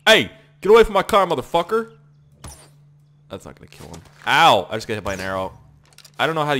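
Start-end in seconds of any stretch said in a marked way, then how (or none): 0.1-1.9 loud, fast, high challenge; mock-tough hollering; shouted warning. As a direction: yell to chase someone off your car. Chases someone from his car.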